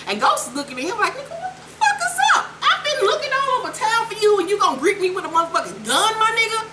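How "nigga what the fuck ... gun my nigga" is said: fast, high-pitched falsetto outrage; mock indignant squeal. Ghost greeted with a gun, mock outraged squeal. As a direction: squeal it in comic disbelief.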